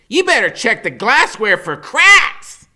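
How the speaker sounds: put-on high, loud wisecracking boy; Freak's high smart-aleck voice. A narrator's reading. Boy joking about his own birthday, high and cocky. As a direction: crack the joke like a cocky kid. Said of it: "he says".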